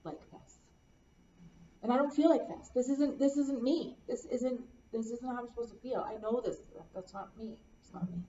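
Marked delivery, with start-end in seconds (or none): 1.8-6.6 louder, higher, emphatic distress; distressed protest. Real, not acted. Client telling counselor this isn't her, distressed protest. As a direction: protest it, raised and insistent.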